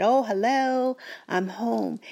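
bright, high sing-song hello as if just arriving; playful pretend arrival greeting; mock cheerful homecoming. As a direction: breeze in pretending to be just home. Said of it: "I said".

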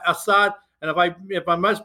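normal talking voice

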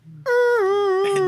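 very high, loud sung squeal. Pretend. Player imitating a horse's cry, [name]-like squeal. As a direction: hit a piercing diva whistle note. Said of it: "It was like [name] singing".

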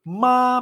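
"ma" held high and level; first tone. Shown as a demonstration. Teacher reviewing mother, "ma" high and level. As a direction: sustain "ma" high without a glide. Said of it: "primer tono".

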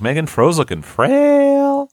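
very high, slow, "frail" held long; exaggerated mock lament. Telling Megan at home about friend, high drawn-out "frail".

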